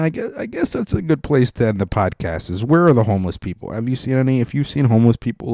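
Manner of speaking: ordinary delivery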